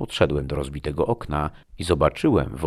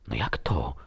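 plain reading voice

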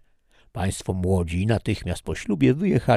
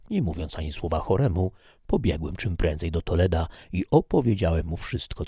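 usual speaking voice

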